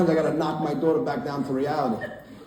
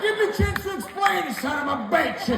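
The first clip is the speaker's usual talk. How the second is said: put-on high-pitched, slow accented dad rant; mock an irate father with a foreign accent; scolding immigrant father. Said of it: "if that guy sounded like my father".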